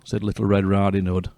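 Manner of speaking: usual speaking voice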